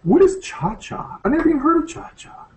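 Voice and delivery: ordinary delivery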